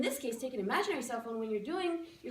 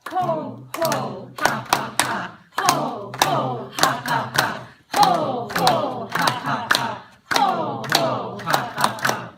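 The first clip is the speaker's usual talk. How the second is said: shouted rhythmic ho ho ha ha, much louder; loud ho ho ha ha chant; 11 dB louder, much slower than usual. Shown as a demonstration. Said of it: "So you can do this with me".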